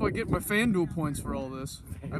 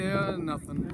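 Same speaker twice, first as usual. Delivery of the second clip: ordinary talk, nothing marked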